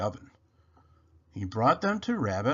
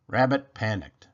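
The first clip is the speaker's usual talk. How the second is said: plain reading voice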